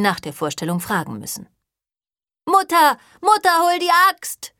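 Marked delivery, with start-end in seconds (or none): 2.5-3.0 loud high call for mother; shouting. Acted. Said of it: "schrie Jack". 3.2-4.5 loud, high, panicked yell; shouting. Acted. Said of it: "schrie Jack".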